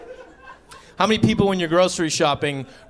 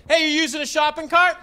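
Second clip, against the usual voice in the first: cheery high call; 12 semitones higher than usual. Retold or imitated. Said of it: "and I go".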